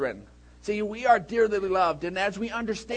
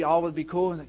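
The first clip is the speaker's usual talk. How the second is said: usual speaking voice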